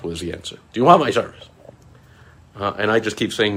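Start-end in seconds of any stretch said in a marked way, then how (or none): 0.7-1.5 mock salesman. Imitating scammer pitch.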